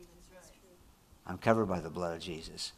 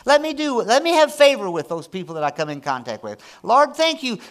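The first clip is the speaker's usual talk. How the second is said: high, loud, gushing prayer voice; imitating Connie's prayer; 13 semitones higher, 10 dB louder than usual. Pastor voicing his wife's morning prayer, high loud and gushing. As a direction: pray it high and loud like an eager wife. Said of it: "I love it when Connie prays that way".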